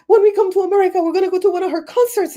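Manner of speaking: excited fans. Retold or imitated. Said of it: "they're like".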